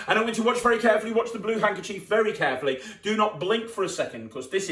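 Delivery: loud, fast showman's build-up; showman hype. Acted. Magician to kids, loud and hyped. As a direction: sell it like a stage magician.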